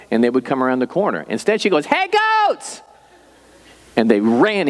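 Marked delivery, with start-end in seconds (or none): 1.9-2.8 put-on piercing child call. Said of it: "she has this piercing voice".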